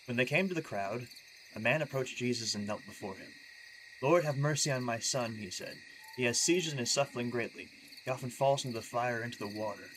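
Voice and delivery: soft, measured Bible reading; scripture reading. Acted. Streamer reading a Matthew passage aloud, soft scripture read.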